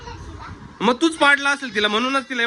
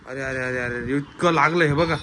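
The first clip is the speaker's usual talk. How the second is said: mock dismay; 9 semitones lower than usual. Hurt child shown to viewers.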